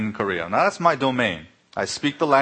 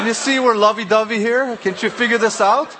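high, louder, laughing through it; laughing mock exasperation; 11 semitones higher, 6 dB louder than usual. Scolding the clerk about the couple, laughing. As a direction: push it out while cracking up.

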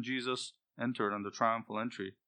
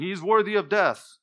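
crowd's verdict; 8 semitones higher, 10 dB louder than usual. Retold or imitated. Crowd condemning Jesus voiced.

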